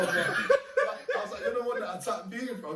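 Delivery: breathy giggle into the line; amused retold accusation; laughing. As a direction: crack up while delivering it.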